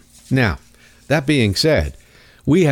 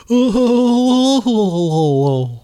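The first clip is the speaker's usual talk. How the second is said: high laugh; 10 semitones higher than usual. Real, not acted. Laughs after the mock voice.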